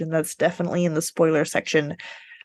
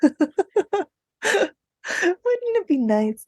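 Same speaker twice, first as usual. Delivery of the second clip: high, slow, laughing daydream; wistful giggly wonder; laughing wistfully; 11 semitones higher, much slower than usual. Real, not acted. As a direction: dreamily wish it aloud through a laugh.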